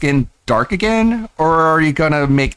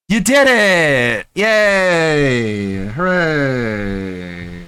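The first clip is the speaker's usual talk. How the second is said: sarcastic cheering; 2 semitones higher, much slower than usual. Acted. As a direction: cheer him on with fake enthusiasm. Sarcastic mock celebration.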